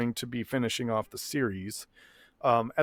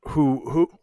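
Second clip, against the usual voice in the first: words broken by hiccups; hiccuping mid-sentence. Real, not acted. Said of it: "ooh, I got the hiccups".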